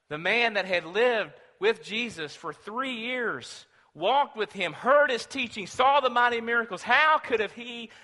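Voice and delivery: fiery preacher in full flight; preaching at full force. Acted. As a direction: build the outrage toward the question. Rising preaching passage.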